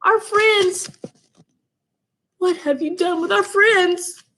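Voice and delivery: high, shocked demand; horrified question; alarmed. A narrator's reading. Dog accusing Wolf over missing friends. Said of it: "he gasped".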